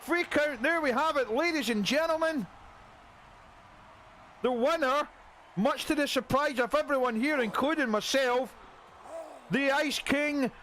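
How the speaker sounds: excited announcer. Acted. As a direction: announce the upset like a thrilled commentator. Match ends, surprise winner announced.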